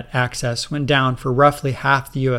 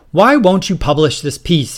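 loud, high angry demand; 5 semitones higher, 6 dB louder than usual. Acted. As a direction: demand it of the editor with heat. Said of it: "he demanded, angrily".

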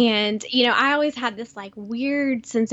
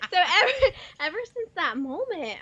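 normal talking voice

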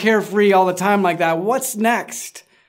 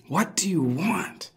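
soft unvoiced whisper, slower; 7 dB quieter, slower than usual. Shown as a demonstration. Pastor repeating Jesus's question to the congregation, whispered. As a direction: breathe the question, drawn out.

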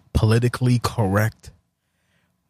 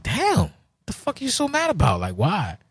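high, strained voice that drops away on the last word; exasperated high whine; 10 semitones higher than usual. Retold or imitated. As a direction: squeak out disbelief, then deflate. Acted quarrel, his own exasperated reply.

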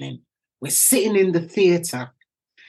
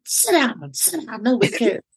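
embarrassed shushing; 5 semitones higher than usual. Retold or imitated. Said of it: "I'm like".

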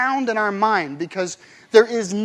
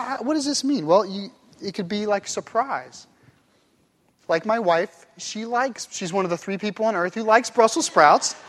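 ordinary talk, nothing marked

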